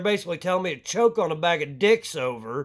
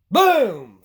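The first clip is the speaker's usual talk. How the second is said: loud, falling "boom"; exclaimed boom; 2 semitones higher, 7 dB louder than usual. Acted. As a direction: punch it out like a sudden blast. Acts out sudden handouts being dealt.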